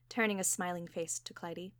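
plain reading voice

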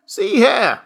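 high, loud querulous burst; sudden announcement. A narrator's reading. Old man announces to father, suddenly indignant. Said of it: "the old man announced suddenly".

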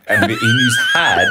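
laughing through the words; breaking up mid-sentence; trying not to laugh. Real, not acted. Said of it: "I'm losing it".

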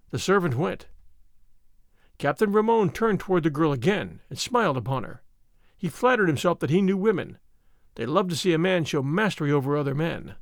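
usual speaking voice

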